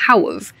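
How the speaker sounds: how've said as one quick syllable; casual how've; reduced how've. Shown as a demonstration. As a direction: squeeze "how have" to "how've". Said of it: "gets reduced to how've".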